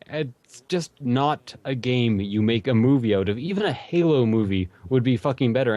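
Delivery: usual speaking voice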